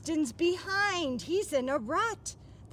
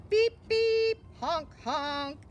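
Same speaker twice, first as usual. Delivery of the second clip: car horn voice; 3 semitones higher, 4 dB louder, slower than usual. Acted. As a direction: honk it out like a toy car. Car horn noises read out.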